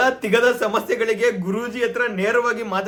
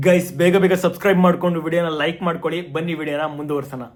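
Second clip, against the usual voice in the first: quick aside; 4 semitones lower, faster than usual. Real, not acted. Aside to viewers.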